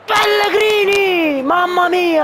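high, drawn-out name call; excited shout. Real, not acted. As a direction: call the player's name in awe. (urlo eccitato del telecronista)

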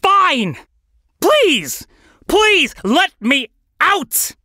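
high, loud grudging plea; frantic plea, drawn out at the end; exasperated begging. Acted. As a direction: give in and shout it. Exasperated bird gives in, begs.

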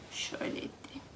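soft whisper to herself; whispered aside. Real, not acted. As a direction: murmur it under your breath. Whispered aside while moving cat. (fısıltıyla kendi kendine)

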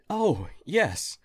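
high, bright "oh" of sudden recall; sudden remembering. Acted. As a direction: jump up in pitch as it comes back to you. Alien suddenly remembers the device.

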